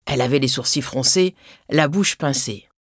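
ordinary delivery

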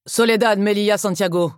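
mildly barked summons; barked name. A narrator's reading. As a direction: call out the full name like an officer. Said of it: "aboya celui du milieu".

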